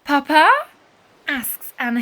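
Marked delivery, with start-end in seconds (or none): 0.0-0.5 calling papa. A narrator's reading. Anna calls to father.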